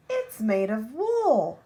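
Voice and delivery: put-on child spots the wool nest, slow loud discovery; excited announcement. A narrator's reading. Child showing the others the nest, slow loud reveal. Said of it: "said the other".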